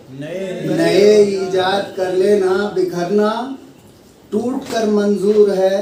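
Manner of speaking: measured mushaira recital with weighted phrases; Urdu poetry recitation. Acted. Poet opening the gathering with a couplet, recited. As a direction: recite in phrases, pausing for the audience's response.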